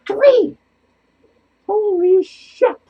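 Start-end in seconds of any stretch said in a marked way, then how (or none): none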